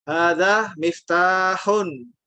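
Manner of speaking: Hadza miftahun very slowly, long a after the dz; model reading. Shown as a demonstration. Teacher models the student's phrase, very slowly. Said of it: "hanya seperti ini ya".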